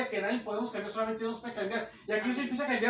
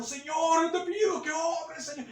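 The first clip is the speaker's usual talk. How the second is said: imitating a tearful prayer; 7 semitones higher than usual. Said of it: "puede una hermana estar llorando".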